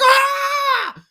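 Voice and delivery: high, loud mocking "ah" yell; mock crowd shout. Mocking Yankee fans on every pop-up, high shout. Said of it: "they're like".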